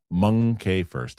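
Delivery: ordinary talk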